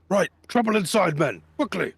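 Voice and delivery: high, loud urgent call; guard rallying men. Acted. Guard calling his men to trouble inside, high urgent shout. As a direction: rouse the troops, urgent.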